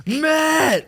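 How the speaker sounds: high calling out a name. Retold or imitated. Wife calling husband after twenty minutes, high. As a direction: call him from downstairs. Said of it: "wife calling from downstairs".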